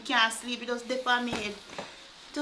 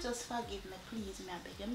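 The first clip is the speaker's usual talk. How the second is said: much softer, begging plea; soft pleading; 3 semitones lower, 15 dB quieter than usual. Acted. Girlfriend begging the betrayed husband to forgive her, soft plea. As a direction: beg him quietly, almost under breath.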